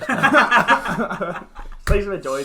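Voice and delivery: slow and loud through laughter; laughing. Real, not acted. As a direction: say it mid-laugh.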